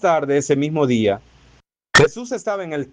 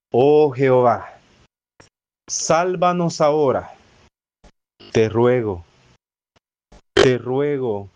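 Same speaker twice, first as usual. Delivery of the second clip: lower, measured reading of the psalm, pleading; solemn scripture reading; 4 semitones lower than usual. Acted. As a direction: read the verse like a prayer, unhurried. Reads Psalm 118 aloud. (lectura solemne del salmo, en tono de súplica)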